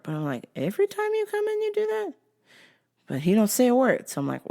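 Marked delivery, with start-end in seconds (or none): 0.6-2.1 high mock question. Mocking customer, high squeak.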